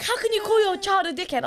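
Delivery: high, loud, outraged mock gasp of a grandmother; shocked mum. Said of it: "She was like".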